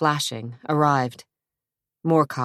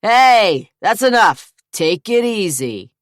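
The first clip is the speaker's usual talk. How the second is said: loud, higher police shout; yelling cop; 6 semitones higher, 8 dB louder than usual. A narrator's reading. Cop to the brawling brothers, loud yell. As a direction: break up the fight. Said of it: "one cop yelled".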